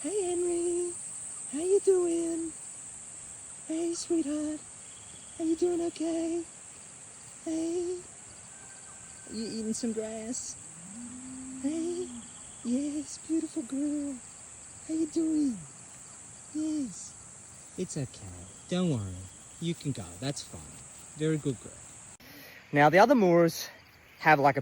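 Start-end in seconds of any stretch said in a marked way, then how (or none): 0.0-17.1 very high, soft, drawn-out sweet talk; gentle animal cooing. Real, not acted. Cooing to a skittish blind cow. 17.8-21.7 hushed, soothing words to an animal; soft reassurance. Real, not acted. Reassuring the nervous cow.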